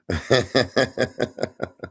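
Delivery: high-pitched rapid chuckle; laughing. Real, not acted. Storyteller amused by own aftertaste joke, high chuckling. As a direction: laugh in a quick, high run.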